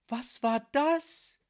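high, drawn-out startled question. A narrator's reading. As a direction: ask it in slow, loud disbelief. Startled girl asks herself.

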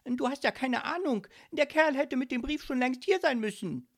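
loud, high, agitated shouting; yelling at someone. A narrator's reading. Ghost yelling at Micky about the late postman. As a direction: shout in high-pitched exasperation. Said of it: "schrie er Micky an".

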